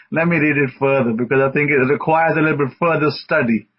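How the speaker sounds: normal talking voice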